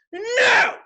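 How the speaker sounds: high startled shout; jump-scare no; panicked yell. Retold or imitated. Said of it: "And I'm just like".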